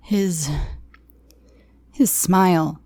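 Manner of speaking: high, small frightened whimper; stammering it out, terrified. Acted. Scared captive whimpering to her captor. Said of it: "I whimpered".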